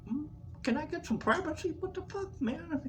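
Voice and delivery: high, soft, hurried mutter; hushed complaint. Retold or imitated. Patient wanting privacy from roommate, hushed exasperation.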